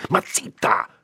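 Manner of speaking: forceful whispered shout; shouting under breath. A narrator's reading. Priest hushing his housekeeper, whispered shout. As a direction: silence her urgently without voicing it. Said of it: "le gridò sottovoce".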